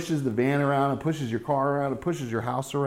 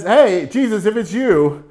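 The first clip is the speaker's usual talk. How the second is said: higher, louder eager shout to Jesus; eager call out; 7 semitones higher, 8 dB louder, slower than usual. Retold or imitated. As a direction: call across the water, keen. Peter voiced calling out.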